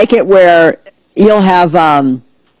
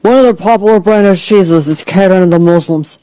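lightly affected imitation of an online poster; mock the complainer with odd vowels; put-on mocking voice. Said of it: "why I'm talking like this".

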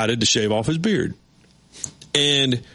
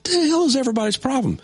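exasperated; 11 semitones higher than usual. Retold or imitated. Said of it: "and I'm like".

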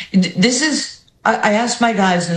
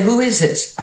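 tough New York "who is this", pitched up; 6 semitones higher than usual. Retold or imitated. Answering a restricted call from [name], tough New York challenge. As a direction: bark it like a suspicious New Yorker. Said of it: "I went so New York in my tone".

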